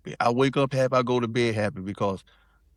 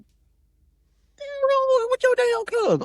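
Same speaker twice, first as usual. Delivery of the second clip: much higher, slow exasperated woman's voice; slower than usual. Retold or imitated. Girlfriend demanding answers about the cousin, high and exasperated. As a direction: play her as fed up and bewildered. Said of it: "And she like".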